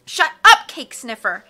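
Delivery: put-on high loud snap of a spoiled girl; rude bratty shout. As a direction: yell it at the child. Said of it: "Carmelita ordered".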